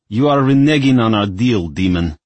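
put-on loud, high cranky old voice; a cranky elder scolding loudly; crotchety complaint. A narrator's reading. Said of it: "The crotchety complaint rang loudly".

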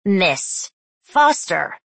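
slow nasal stern teacher; nasal, drawn-out call. Acted. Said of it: "Mister Sweeney's nasal voice".

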